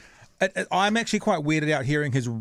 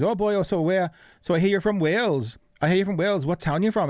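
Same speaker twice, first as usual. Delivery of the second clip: higher, lilting Welsh impression; chatty Welshman, pitched up; Welsh accent; 5 semitones higher than usual. Film extra greeting the star, in a Welsh accent. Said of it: "in his Welsh accent says".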